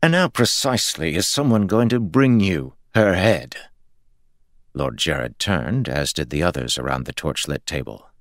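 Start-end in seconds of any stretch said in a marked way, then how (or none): 0.0-3.6 skeptical. A narrator's reading. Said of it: "Ares' skeptical voice".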